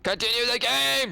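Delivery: shrill impatient shout; high yell. Real, not acted. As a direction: yell at him to go on.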